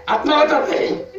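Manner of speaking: snigger under your breath like a demon; mock suppressed titter; imitated demon giggle. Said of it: "ముసి ముసి నవ్వులు, పెద్ద నవ్వులు కాదు".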